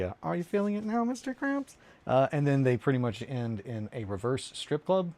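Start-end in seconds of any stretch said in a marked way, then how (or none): 0.2-1.8 high singsong cartoon line; cartoon quote. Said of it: "But yeah, the".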